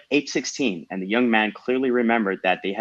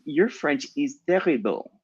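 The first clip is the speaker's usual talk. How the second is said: put-on slow, higher voice with thick French accent; small French girl; 6 semitones higher than usual. A narrator's reading. Girl judges Daniel's French, in a thick French accent. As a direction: draw it out as a little French girl. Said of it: "small girl's voice in a thick French accent".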